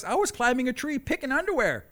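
usual speaking voice

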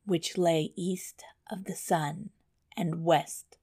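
soft, fading closing words; storybook ending. A narrator's reading. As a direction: let the tale drift to its end. Fairy tale closing line.

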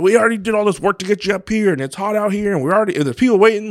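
mock an impatient father pushing his son; impatient dad. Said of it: "I didn't".

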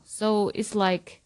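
usual speaking voice

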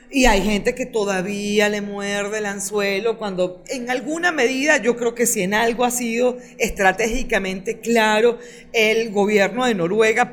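usual speaking voice

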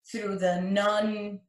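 usual speaking voice

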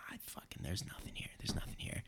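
frustrated whisper. Retold or imitated. Hunters hiking with no moose in sight, frustrated whisper. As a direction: hiss the words out silently on the hunt.

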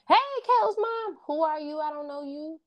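high, bright-eyed call of hello across the hall; excited school greeting. Retold or imitated. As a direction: greet Caleb's mom like a delighted kid spotting her. People at school greeting her.

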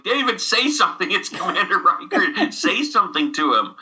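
high, insistent imitation of a mom prodding her child; urging mother. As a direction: coax a tongue-tied kid, half laughing. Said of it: "And the mother starts going".

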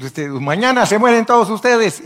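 loud, high doom announcement; high-pitched shouted threat to a crowd; prophetic warning. Acted. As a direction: proclaim it loudly like a doomsday prophet.

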